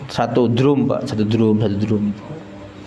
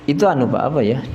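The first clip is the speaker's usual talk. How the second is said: ordinary talk, nothing marked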